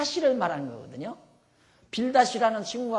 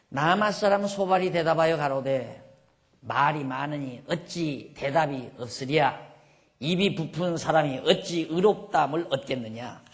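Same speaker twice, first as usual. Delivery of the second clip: scripture reading; 6 dB louder than usual. Acted. Reads scripture aloud.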